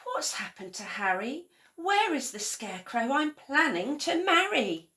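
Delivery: rising to a high, anxious question; a touch of fretting for Harry; worried. A narrator's reading. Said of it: "Betty was worried".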